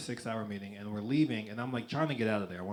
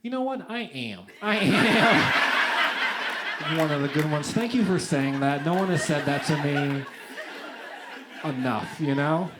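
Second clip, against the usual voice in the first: mock gushing thanks, voice pitched up and louder; 4 semitones higher, 7 dB louder than usual.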